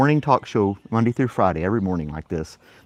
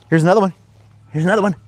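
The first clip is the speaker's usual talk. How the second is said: high excited dog voice; 9 semitones higher, 6 dB louder, faster than usual. Real, not acted. Offering toy to dog.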